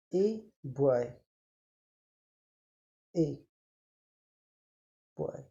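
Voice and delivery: ए and बॉय spaced apart, each said clearly and slowly; slow word demo. Shown as a demonstration. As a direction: sound out ए बॉय word by word for the class. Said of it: "देखो". (शब्द को धीरे-धीरे अलग करके बोलना)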